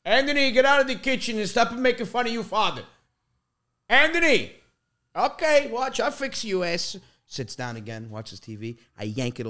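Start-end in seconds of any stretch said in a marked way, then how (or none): 0.0-4.5 loud, high-pitched imitation of an angry dad; mock-yell like an exasperated father. Son imitates dad yelling toward the kitchen, angry shouting. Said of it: "He goes". 5.2-7.0 high-pitched imitation of dad's threat; threatening dad. Son imitates dad warning the prankster, mock threatening. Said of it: "He goes".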